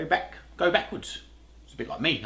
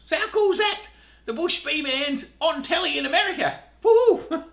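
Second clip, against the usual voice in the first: excited cheer; 12 semitones higher than usual. Real, not acted. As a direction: pitch jumps way up, whooping with joy. Excited about being on US TV.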